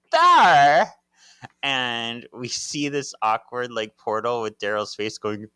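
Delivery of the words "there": high, loud pointing yell; sudden shout. Retold or imitated. Paige spotting Daryl's portal, loud shout. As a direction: call it out as you spot it.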